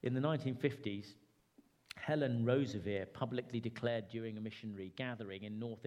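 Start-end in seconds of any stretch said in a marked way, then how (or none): none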